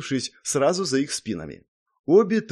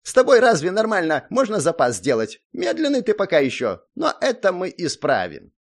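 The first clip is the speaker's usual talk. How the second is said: higher, complaining character voice; disgruntled Zak; 7 semitones higher, 5 dB louder than usual. A narrator's reading. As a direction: grumble it in a pitched-up voice. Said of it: "недовольно фыркнул он".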